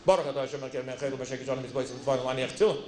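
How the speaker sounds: imitating a rushed, low Hebrew recitation; very fast, low-pitched prayer gabble; rattled-off blessing. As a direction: race through the blessing by rote, low-pitched. Student rattles off blessing to prove himself.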